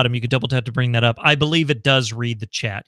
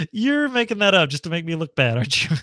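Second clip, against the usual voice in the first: higher-pitched, teasing through laughter; laughing accusation; 4 semitones higher than usual. Real, not acted. Streamer joking that a viewer made it up, laughing. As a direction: accuse them playfully, breaking up laughing.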